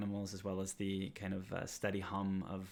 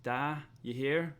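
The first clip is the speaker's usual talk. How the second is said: calling to father; 6 semitones higher, 6 dB louder, slower than usual. Acted. Cole calls into father's house.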